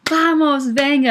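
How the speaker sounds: high, loud imitation of a sideline cheer. Military woman urging on a walking runner, imitated loud cheer. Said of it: "she was cheering from the sideline".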